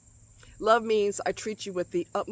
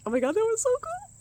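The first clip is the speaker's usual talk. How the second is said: much higher, fast, gushing; squealing delight; 4 dB louder, faster than usual. Real, not acted. Thrilled by the hummingbird.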